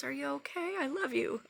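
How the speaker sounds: tender mom voice, high and soft; caring mom. Retold or imitated. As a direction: gently imitate a worried, loving mother. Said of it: "I hear my mom's voice and she says".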